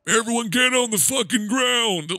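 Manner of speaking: high, drawn-out mock holler; hammed-up strained command; imitated movie yell. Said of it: "weird to hear him be like".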